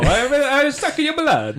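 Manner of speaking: high, hammy Italian Dracula voice; Italian-accented Dracula. Retold or imitated. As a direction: ham up an Italian accent as a vampire. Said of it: "actually kind of do an Italian accent".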